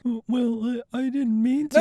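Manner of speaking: high, nervous stammer; stammering high apology. Acted. Fan stammers a nervous apology to angry Jung. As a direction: fumble an excuse.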